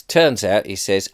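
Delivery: ordinary delivery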